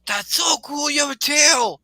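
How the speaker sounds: put-on very high-pitched, loud excited kid; Tony, awestruck. Game master as Tony admiring a tail from below, awestruck. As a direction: squeal it up to someone overhead. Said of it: "and Tony's like".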